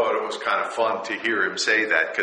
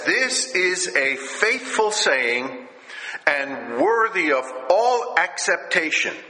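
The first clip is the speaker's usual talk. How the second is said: raised, slow preacher's reading of scripture; preaching scripture; 7 semitones higher, 4 dB louder, slower than usual. Acted. Pastor reading scripture to his congregation, raised and slow. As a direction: proclaim the verse high and slow like a preacher.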